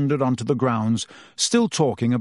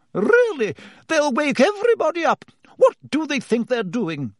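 high, fussy voice of an alarmed nurse; a scandalised matron hushing the noise; 14 semitones higher than usual. Acted.

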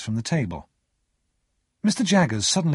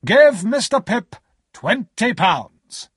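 high, loud, slow order; loud, drawn-out, imperious instruction; calling out; 10 semitones higher, 6 dB louder, much slower than usual. A narrator's reading. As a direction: call the order across the office. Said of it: "cried as he left the office".

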